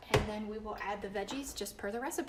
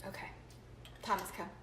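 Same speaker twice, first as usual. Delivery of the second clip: ordinary talk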